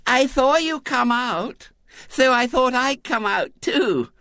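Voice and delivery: put-on very high lisping child; little-girl voice. A narrator's reading. Little girl trotting beside William, high lisping voice. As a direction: pipe it up slowly like a determined small girl.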